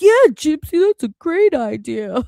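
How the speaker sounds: high, loud bubbly mock of Sheena; mock cheerful yes-woman voice; mocking imitation. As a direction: play her as eagerly agreeing, hammed up. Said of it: "She'd be like".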